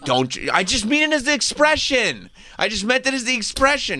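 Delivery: high, defensive put-on voice; mock Billy Zane. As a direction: protest in a squeaky voice. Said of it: "he's like".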